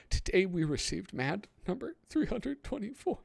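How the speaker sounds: high, wobbly fake crying; mock crying voice. Reading a reader's letter in a mock crying voice. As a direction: pretend to weep through the words. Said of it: "I'm doing a crying voice".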